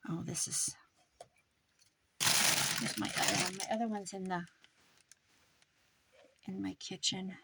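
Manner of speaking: whispered to herself; whispering. Real, not acted. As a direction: whisper it under your breath. Aside under breath.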